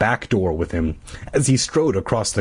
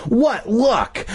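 excited; 12 semitones higher than usual. A narrator's reading. Bee delighted computer is on.

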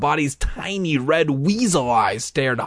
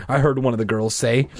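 ordinary delivery, nothing marked

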